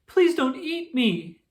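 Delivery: high, slow frightened plea; begging in fear. A narrator's reading. Squirrel begs the hawk not to eat her, high and slow. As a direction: beg for your life.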